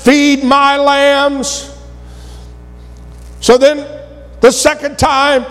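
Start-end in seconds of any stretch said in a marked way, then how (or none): 0.0-1.7 each word drawn out slowly; slow command. Retold or imitated. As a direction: lay "Feed my lambs" down slowly, word by word. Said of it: "then he says".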